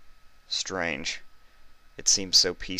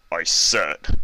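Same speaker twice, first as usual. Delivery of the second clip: menacing; 6 dB louder than usual. A narrator's reading. Guard threatens Vinyl.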